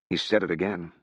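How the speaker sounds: ordinary delivery, nothing marked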